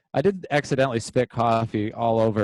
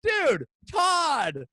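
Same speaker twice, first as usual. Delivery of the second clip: much higher, shouted mock old man; much slower than usual. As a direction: squeak then shout his name. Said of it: "you just hear me goes".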